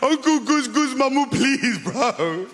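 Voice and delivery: put-on high, whiny pleading kid; begging nephew. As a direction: beg your uncle not to tell.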